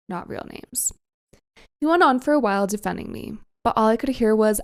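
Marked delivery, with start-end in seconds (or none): 0.1-0.9 soft unvoiced whisper; whispered aside. Real, not acted. Narrator quietly noting the names are changed, whispered. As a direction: slip it in under your breath. Aside during Reddit story reading.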